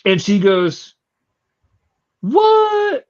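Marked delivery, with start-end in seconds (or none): 2.2-3.0 high outraged what; shocked what. Retold or imitated. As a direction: shriek it in disbelief. Clerk shocked by racist basket.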